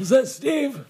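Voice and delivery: put-on very high elderly voice; mock old voice, high-pitched; confused old-timer. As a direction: squeak the question like a befuddled elder. Reprise of the old-timer bit.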